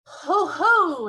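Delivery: high, loud giant's ho ho; the giant remembering with glee; high, loud booming exclamation; triumphant cry. A narrator's reading. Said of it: "he cried".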